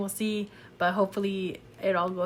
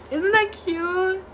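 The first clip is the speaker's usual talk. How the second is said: high, drawn-out delighted gush; 9 semitones higher, 5 dB louder, slower than usual. Real, not acted. Reader gushing over a cute line, high drawn-out delight. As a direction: coo it loudly over something adorable.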